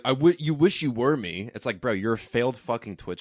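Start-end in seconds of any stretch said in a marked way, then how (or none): 0.0-1.5 higher, louder mock of a boastful streamer; hammed-up cocky boast, pitched up; mocking quote. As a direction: parody his smug brag.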